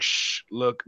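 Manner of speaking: imitated secretive hush. Mimicking his secret shout-out, lightly hushed. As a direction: hush them as if sharing a secret. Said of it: "that was some secret shit".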